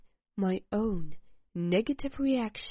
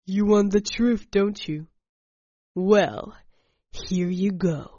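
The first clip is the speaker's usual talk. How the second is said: threatening; 6 dB louder than usual. Acted. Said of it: "The statement sounded like a threat".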